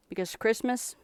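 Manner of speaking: normal talking voice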